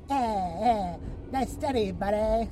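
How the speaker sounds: lightly hammed Canadian accent; smug mock-Canadian buddy voice; Canadian scientist. Canadian scientist with a cigarette after the study, mock Canadian accent. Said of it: "Canadian scientist".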